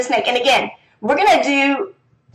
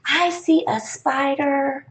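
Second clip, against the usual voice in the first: very slow, softer sentence reading; 3 semitones higher, 4 dB quieter, much slower than usual. Shown as a demonstration. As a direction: read it slowly, leaving the gap. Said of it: "here's another one for".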